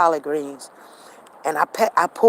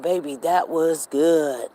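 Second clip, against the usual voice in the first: imitating husband; 3 semitones lower, slower than usual. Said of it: "He was like".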